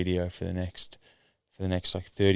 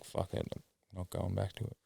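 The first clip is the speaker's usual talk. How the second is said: muttered; 14 dB quieter than usual. Retold or imitated. Frustrated shopper giving up.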